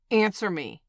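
loud, hard command; angry demand. Acted. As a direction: bark the order. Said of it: "I'm sorry for yelling".

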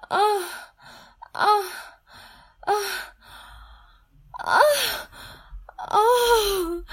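high, loud sobbing cries of release; high ecstatic sobbing; climax cries. Acted. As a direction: cry out to him at the peak. Climax cries to her hero.

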